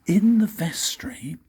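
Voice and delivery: ordinary delivery, nothing marked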